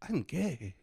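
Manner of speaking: put-on showing the cohost his Tokyo accent, lightly. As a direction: mimic the Tokyo man's accent gently. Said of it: "And what accent is that?".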